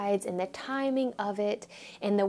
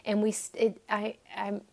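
normal talking voice

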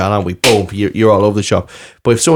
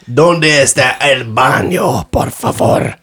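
put-on hammy Spanish accent; 3 semitones higher, much slower than usual. Joking tourist Spanish.

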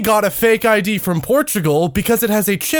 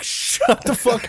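higher, drawn-out mock telling-off; retold rebuke; 6 semitones higher, slower than usual. Retelling how she hushed a friend in public, exasperated. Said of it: "And I'm like".

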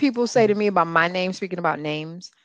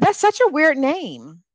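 higher, slow mimicry; drawn-out, pitched-up parody; mock rude remark; 7 semitones higher, slower than usual. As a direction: drag it out, imitating the tactless speaker.

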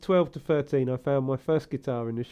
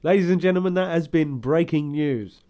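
mock newsreader sign-off; 5 dB louder than usual.

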